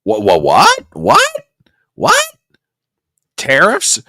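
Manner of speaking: loud, climbing shocked what; disbelieving what. Real, not acted. Host hearing Biden announce tariffs, loud rising what. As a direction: leap up in disbelief.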